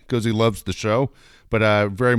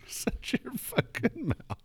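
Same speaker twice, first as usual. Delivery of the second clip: laughing echo; 12 semitones higher, 13 dB quieter, slower than usual. Real, not acted. Echoes the line laughing.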